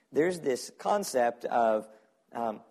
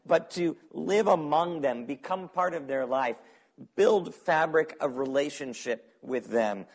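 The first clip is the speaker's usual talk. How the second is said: impassioned preaching; 4 dB louder than usual. Acted. Preaching Jesus's words.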